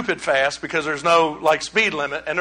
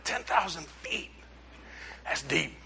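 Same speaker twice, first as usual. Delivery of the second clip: very soft, slow, amazed echo; hushed awe; 4 semitones lower, 16 dB quieter than usual. Retold or imitated. Preacher recalls reacting to the captain's depth figure, hushed. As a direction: say it under your breath, impressed.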